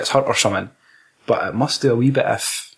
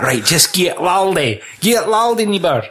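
high, drawn-out egging-on voice; mock director; 7 semitones higher than usual. Director telling the actor to go wild, high mock call. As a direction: urge the actor to go all out, hammed up.

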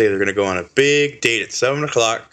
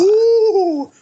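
high wavering ghostly ooh; spooky ghost ooh; 5 dB louder than usual. Acted. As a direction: moan it like a cartoon ghost. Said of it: "make a spooky greeting".

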